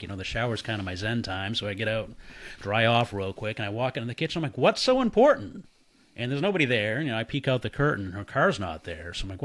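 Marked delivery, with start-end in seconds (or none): 4.6-5.6 loud, high, irritated call; annoyed call. Retold or imitated. Walking into the empty kitchen calling out, annoyed loud call.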